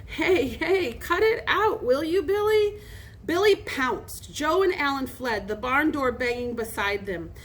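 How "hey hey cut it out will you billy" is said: high, uneasy protest; nervous chuckle. A narrator's reading. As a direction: plead nervously in a raised pitch. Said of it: "chuckling nervously".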